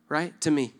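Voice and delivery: ordinary talk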